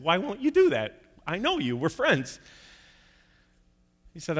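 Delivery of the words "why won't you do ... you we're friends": higher, indignant disbelief; flabbergasted. Retold or imitated. Groom protesting to the pastor in mild disbelief. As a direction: act out a stunned friend. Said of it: "the guy was flabbergasted".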